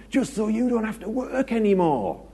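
husband proudly explaining his sacrifice; mock proud husband. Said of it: "And he says".

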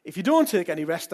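ordinary delivery

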